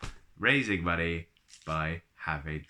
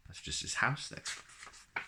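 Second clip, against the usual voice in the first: a breathy hushed aside to the viewers; whispered aside; 5 semitones higher, 9 dB quieter, slower than usual. Real, not acted. Presenter whispers a quick aside to viewers, hushed. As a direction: drop to a whisper, just between us. Aside while showing the book.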